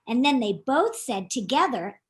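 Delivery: ordinary delivery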